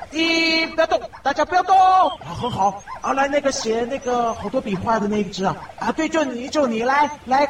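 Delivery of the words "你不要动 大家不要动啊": urgent call. Acted. Ordering otters to hold still.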